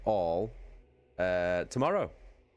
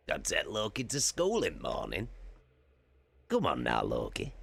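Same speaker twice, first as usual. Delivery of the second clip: a lightly put-on Tarek voice, lower at the end; Tarek Targinen voice; 3 semitones lower than usual. Telling chat he must take Loki to school, lightly mimicking Tarek. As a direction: lightly slip into his mate's voice. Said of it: "my fucking Tarek Targinen voice".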